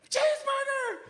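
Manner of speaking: very high, loud joyful roar; very high, loud shouted "Cheeseburger!"; child's holler. Retold or imitated. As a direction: holler it like a thrilled little girl. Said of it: "she let out this roar, this holler".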